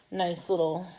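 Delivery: ordinary talk, nothing marked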